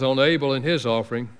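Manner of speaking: ordinary talk, nothing marked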